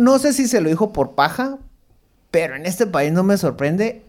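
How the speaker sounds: usual speaking voice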